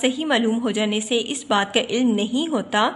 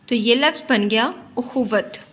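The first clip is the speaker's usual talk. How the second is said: ordinary talk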